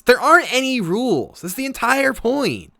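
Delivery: put-on very high, loud, cheeky child; defiant little girl. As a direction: fire it back with a grin. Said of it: "She goes".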